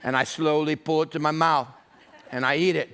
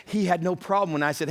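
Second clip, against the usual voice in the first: ordinary talk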